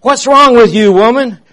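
high, loud furious yell. Retold or imitated. Young man yells at old woman wrecking car, loud high yell. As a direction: yell at her in outrage. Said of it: "yelling at her".

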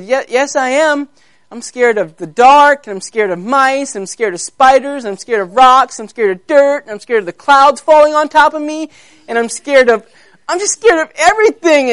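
high-pitched, anxious list of fears; scared baby lion. Acted. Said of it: "here was a little baby lion".

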